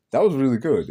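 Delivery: ordinary talk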